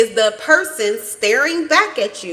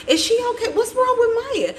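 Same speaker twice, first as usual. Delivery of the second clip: worried grandma; 14 semitones higher than usual. Retold or imitated. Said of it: "she asked her daddy".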